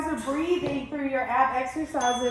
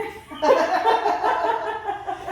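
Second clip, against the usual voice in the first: loud laugh; 9 semitones higher, 7 dB louder than usual. Real, not acted. Laughs at her own struggle.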